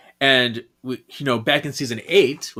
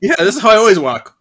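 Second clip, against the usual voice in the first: fast, higher, louder joking voice; mock character voice; 8 semitones higher, 9 dB louder, faster than usual. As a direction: pretend to be Benjamin walking. Mocking Benjamin's walk.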